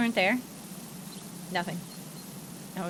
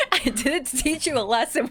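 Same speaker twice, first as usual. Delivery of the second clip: laughing mock dad; 3 semitones higher than usual. Mocking her dad's lesson line while laughing. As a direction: quote dad through laughter. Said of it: "my dad was a very much like".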